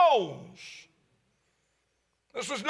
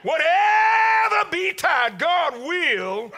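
preaching shout; 6 semitones higher, slower than usual. Retold or imitated. Preacher to congregation, quoting the old deacon, shouting. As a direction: proclaim the deacon's words with full fire. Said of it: "Deacon J E McDaniel said".

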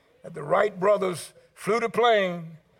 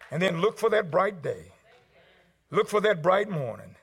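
ordinary talk, nothing marked

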